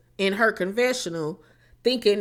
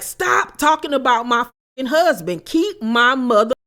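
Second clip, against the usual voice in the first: indignant snap; 4 semitones higher, 6 dB louder, slower than usual. Retold or imitated. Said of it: "Rachel was like".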